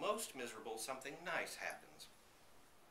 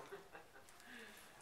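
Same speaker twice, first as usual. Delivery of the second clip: put-on soft breathy old man's laugh; old man's chuckle; 10 semitones higher, 13 dB quieter than usual. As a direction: chuckle quietly.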